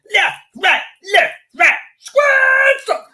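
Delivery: high, loud parade-ground shout; drill sergeant. Retold or imitated. Mimicking a sergeant drilling police on parade, barked shout. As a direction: bellow marching orders like a drill sergeant. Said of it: "drill sergeant go".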